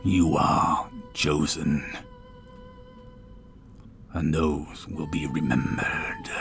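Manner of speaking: deep, slow, whispery stone voice; talking statue. Acted. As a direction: drag each word out like a god in stone. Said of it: "In a deep voice that almost seems to permeate".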